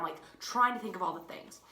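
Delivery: usual speaking voice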